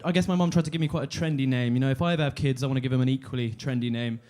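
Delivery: normal talking voice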